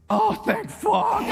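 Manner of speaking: high, long drawn-out mock drunk relief; Steve, drunken relief. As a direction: groan it out as a drunk finally finding the toilet. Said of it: "that's such a good impression".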